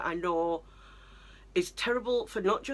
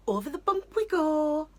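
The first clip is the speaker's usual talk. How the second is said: high, louder mock baby talk; mocking sing-song; 10 semitones higher, 4 dB louder than usual. Daughter mocking mum's child talk in high sing-song. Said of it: "I don't need you to go".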